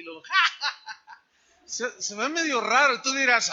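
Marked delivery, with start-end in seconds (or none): 0.2-1.7 high put-on laugh, quickly fading; imitated mocking laugh. Healer calls patient over, imitated mocking laugh. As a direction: act out laughing at sickness. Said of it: "riéndome de las enfermedades".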